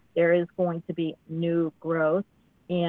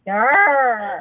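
dragon roar; 5 semitones higher, 7 dB louder than usual. Retold or imitated. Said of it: "can you do that again".

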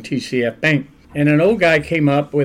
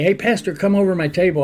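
higher, a mild retold call across the room; mock holler; 6 semitones higher, faster than usual. Old man calling the pastor over in Big Boy, mild mock holler. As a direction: call out to him across the diner, lightly. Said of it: "he hollered across at me".

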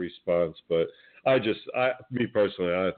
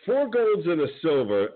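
rising higher, amazed emphasis; excited; 8 semitones higher than usual. Real, not acted.

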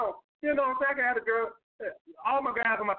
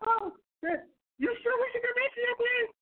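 high, mock girly voice playing innocent; coy girl; 14 semitones higher than usual. Mocking a date who plays hesitant, high girly voice. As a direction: imitate a coy woman pretending she's unsure. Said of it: "She gonna sit there and be".

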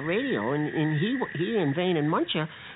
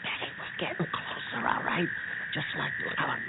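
hushed whisper on the approach; closing in on the junkyard, whispering to Betty; 6 dB quieter than usual. Acted. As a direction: breathe the words, barely voiced. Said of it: "just be quiet".

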